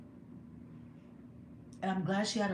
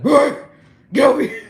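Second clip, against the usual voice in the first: huge barked man-style shout; deep man voice; 8 semitones higher, 20 dB louder than usual. Retold or imitated. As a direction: holler it like a commanding father. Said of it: "You hear my deep man voice?".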